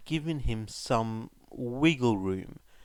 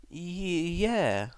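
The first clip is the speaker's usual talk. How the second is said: hesitant yes; 6 semitones higher than usual. A narrator's reading. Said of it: "he confirmed".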